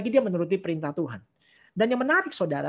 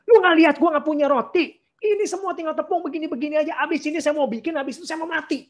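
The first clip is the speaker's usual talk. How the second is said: much higher, louder exasperated retort; snapping back; 9 semitones higher, 4 dB louder than usual. Acted. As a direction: fire it back at him. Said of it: "pasti ngegas ini pasti".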